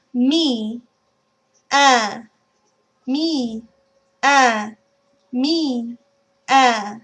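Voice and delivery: "Me" then nasal "uh" with lips stretched. Shown as a demonstration. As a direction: alternate "Me" and a nasal "uh", lips kept wide. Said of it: "use the E sound in me to start the shape".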